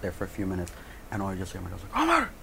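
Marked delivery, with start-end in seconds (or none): 2.0-2.3 sudden high-pitched call; high parental summons; dad calling out. Retold or imitated. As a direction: call the name sharply, summoning your kid. Dad calls him in from outside.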